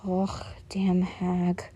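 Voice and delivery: slow breathy whisper of disgust; whispered grumble about his mother; muttering. A narrator's reading. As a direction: hiss it under your breath, slowly. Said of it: "Bakugo muttering".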